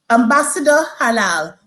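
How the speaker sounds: normal talking voice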